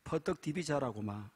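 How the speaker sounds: lightly played Gyeongsang dialect couple banter; a touch of Gyeongsang dialect, teasing. Preacher telling a dialect joke, in Gyeongsang dialect. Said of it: "경상도 여자".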